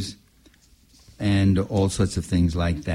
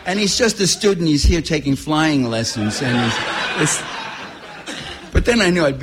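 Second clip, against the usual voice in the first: laughing; 5 semitones higher, 3 dB louder than usual. Real, not acted. Joking about what he nearly said.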